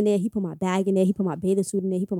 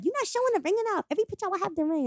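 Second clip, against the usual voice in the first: lightly mimicked online commenters, pitched up; mock commenters; 10 semitones higher, 7 dB quieter, faster than usual. Woman quoting followers about her ring pictures, mildly mocking. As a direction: imitate them in a higher, softer voice.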